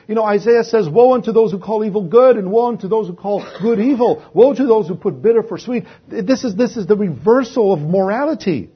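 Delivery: high, loud pulpit fervour; full-force preaching. Acted. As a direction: rouse the congregation from the pulpit.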